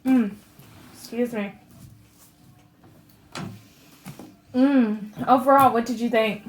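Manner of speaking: talking through hiccups. Real, not acted. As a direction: speak with hiccups breaking in. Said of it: "I got the hiccups".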